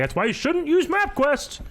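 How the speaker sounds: high-pitched parody of a know-it-all dad; mock dad. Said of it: "My dad would be like".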